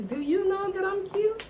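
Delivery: high-pitched, cheeky retort; sassy comeback. Retold or imitated. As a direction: toss it back high and full of sass. Acting her sassy comeback.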